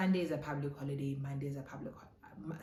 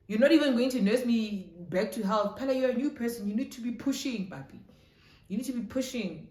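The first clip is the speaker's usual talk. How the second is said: raised, higher voice of protest; indignant complaint; 6 semitones higher, 8 dB louder than usual. Retold or imitated. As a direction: scold a new boyfriend for not trying. Acted protest at boyfriend's excuse.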